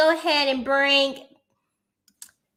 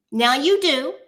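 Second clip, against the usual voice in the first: ordinary delivery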